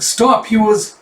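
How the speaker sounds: put-on high, loud childish accusation; a boy shouting blame; high kid voice, indignant; accusing kid. Kids quarrel.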